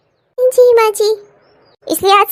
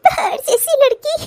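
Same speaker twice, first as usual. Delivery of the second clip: laughing; 4 semitones higher than usual. Acted. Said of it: "तुम इतना हंस क्यों रही हो".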